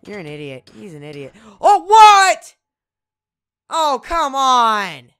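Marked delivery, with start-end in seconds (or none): 1.6-5.1 loud, high indignant complaint; frustrated shout. Real, not acted. Gamer losing a fight, frustrated loud protest. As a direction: protest the unfair loss.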